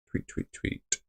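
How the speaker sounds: breathy, barely voiced muttering; whispered mutter. Real, not acted. Streamer whispering to himself while typing a tweet, whispered mutter.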